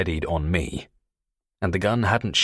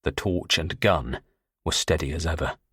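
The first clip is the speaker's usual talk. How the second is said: ordinary delivery, nothing marked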